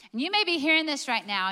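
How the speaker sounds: normal talking voice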